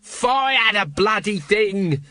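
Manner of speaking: high, slow scream. A narrator's reading. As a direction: scream it in panic. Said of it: "screamed Nobby".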